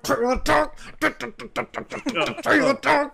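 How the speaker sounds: high-pitched show jingle shout; segment announcement. Pretend. Host launching the trailer segment, high hyped announcement. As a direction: call out the segment name in a high voice.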